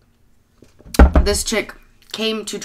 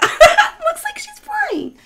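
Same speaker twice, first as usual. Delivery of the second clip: slow, squealing laugh through the words; laughing at the dog's flying ears; much slower than usual. Real, not acted. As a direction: giggle it out high and drawn.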